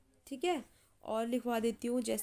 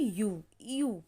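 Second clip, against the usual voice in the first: "यू, यू" drawn out slowly, each yu held; slow sound-out; much slower than usual. Shown as a demonstration. Teacher sounds out yu slowly for students. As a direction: stretch each yu slowly for the class. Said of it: "तो इसको क्या पढ़ा गया है?".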